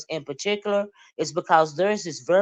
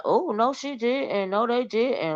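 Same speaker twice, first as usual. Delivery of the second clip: higher, slow oh no she didn't; mock outrage; 7 semitones higher than usual. Reacting to the shady posts, mock scandalized and higher. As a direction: ham up the shocked gasp. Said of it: "And I was like".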